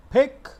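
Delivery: "pick" with a strong puff on the p; aspirated p. Shown as a demonstration. Teacher modelling the right "pick" for students, p aspirated. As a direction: punch out "pick" high and loud, p aspirated. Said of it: "not pick".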